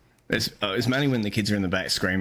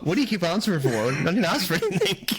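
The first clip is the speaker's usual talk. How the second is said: higher, laughing through it; mocking the confused stranger while cracking up; laughing mock complaint; 7 semitones higher than usual. Voicing the baffled bloke at the next box, laughing. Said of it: "He's just sitting there like".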